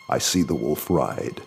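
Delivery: ordinary delivery, nothing marked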